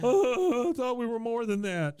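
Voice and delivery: high-pitched whiny heartbroken Bobby; mock bawling. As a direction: wail it like a dumped boy, hammed up. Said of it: "he is just balling at this point".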